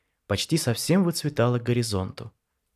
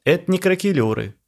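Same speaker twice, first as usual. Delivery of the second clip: scoffing; 8 semitones higher, 6 dB louder than usual. A narrator's reading. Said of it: "хмыкнул Жора".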